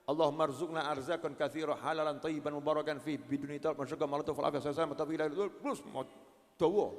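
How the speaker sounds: low, soft recited prayer; quiet devout supplication; prayer recitation. Acted. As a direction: chant the prayer gently.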